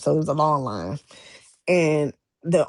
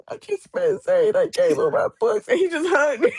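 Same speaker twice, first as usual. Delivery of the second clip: laughing through emotion; 12 semitones higher than usual. Retold or imitated. Retelling meeting idol, emotional.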